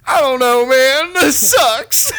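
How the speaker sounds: high, loud laughing groan; retelling a bombed set, laughing; laughing despair. Retold or imitated. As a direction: laugh through the defeat. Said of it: "And I was like".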